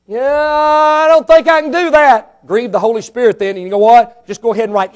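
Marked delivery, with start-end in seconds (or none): 0.1-2.5 slow, higher put-on voice of a balking churchgoer; reluctant churchgoer. Churchgoer refusing God's instruction, slow and higher. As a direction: drawl it out, higher, unwilling.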